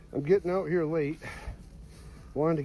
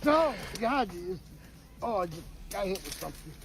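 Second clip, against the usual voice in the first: being chased; 3 semitones higher, 9 dB quieter than usual. Real, not acted. Hit by something while running.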